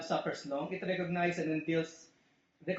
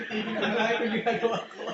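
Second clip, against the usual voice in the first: laughing through it; 5 semitones higher, 6 dB louder than usual. Retold or imitated. Laughing while mock-crying.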